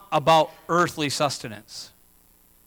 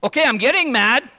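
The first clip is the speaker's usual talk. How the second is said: higher, louder mock irritation; hammed-up snap of annoyance; mock getting mad; 5 semitones higher, 4 dB louder than usual. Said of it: "when we get questioned over and over".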